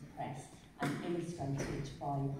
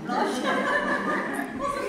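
high, loud burst of sheepish laughter; embarrassed laugh; 12 dB louder than usual. Real, not acted. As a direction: laugh it off, caught out. Embarrassed laugh about online dating.

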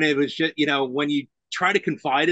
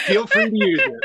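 said through laughter, pitched way up; laughing; 4 dB louder than usual. Real, not acted. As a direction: giggle the offer out high. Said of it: "laughing through the line".

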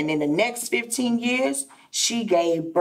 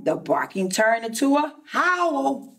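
ordinary delivery, nothing marked

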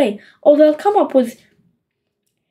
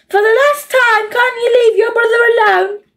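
much higher, fed-up mother voice; exasperated mum; 9 semitones higher than usual. A narrator's reading. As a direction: scold like a mother at her limit. Mother losing patience.

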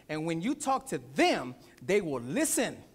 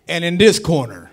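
booming wrestling announcer call, much louder; ring announcer; 18 dB louder than usual. Pretend. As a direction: bellow it like a ring announcer opening a bout. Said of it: "ding, ding, ding".

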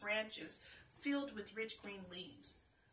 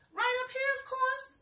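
much higher, louder snap; impatient tree snapping from above; snapped; 11 dB louder than usual. A narrator's reading. Tree answers the girl from the branches, snapping loudly. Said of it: "he snapped".

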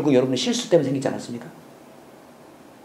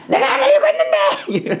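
much higher, slow hammed-up wailing with a laugh; mock tearful repentance; slower than usual. Preacher parodies people crying in repentance, mock wail. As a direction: parody someone sobbing over their sin. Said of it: "사람들이 울면서 회개해요".

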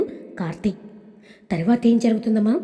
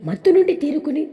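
plain reading voice

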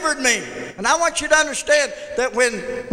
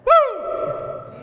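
high joyful whoop, real; closing shout of joy to the church; exultant whoop; 13 semitones higher than usual. Real, not acted. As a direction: throw out a triumphant woo. Preacher's exultant cry.

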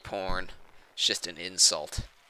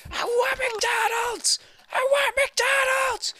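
very high, drawn-out shriek; screeching tantrum; slower than usual. Pretend. Player demanding McDonald's, shrieking tantrum.

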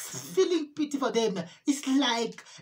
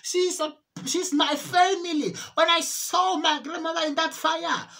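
ordinary talk

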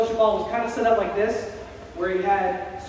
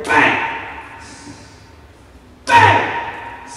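explosive imitated bang; loud banging noise; 10 semitones higher, 6 dB louder than usual. Imitating a loud noise from the kitchen, explosive bangs. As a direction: burst it out sharply, very loud. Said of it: "really loud noise".

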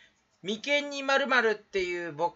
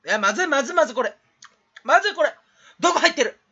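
loud, high, urgent outburst; panicked alarm; 6 semitones higher, 7 dB louder, much faster than usual. Acted. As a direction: blurt it out in rising panic. Panics over something gone wrong. (焦って甲高く大声で)

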